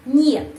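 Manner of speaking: "нет" high and loud, as "nyet"; pronunciation demo. Shown as a demonstration. Teacher repeats it without mo, emphatic "нет". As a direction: punch "нет" like the end of monyet. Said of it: "pengucapannya seperti nama binatang".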